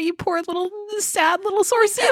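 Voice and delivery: put-on high-pitched baby-talk sympathy; gushing, hammed-up pity; mock cooing pity. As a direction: coo playfully over a poor little thing. Cooing pity at a sad character.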